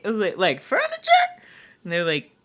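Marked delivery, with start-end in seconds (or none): 0.4-1.1 high, rising, disbelieving question; incredulous question. Retold or imitated. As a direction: ask it like the request makes no sense. Surprised at the request.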